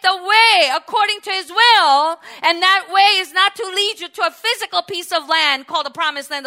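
high, loud, driving proclamation; fervent preaching. Real, not acted. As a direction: preach it out to the whole congregation, building to a Hallelujah. Preaches at full force.

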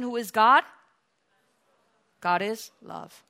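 usual speaking voice

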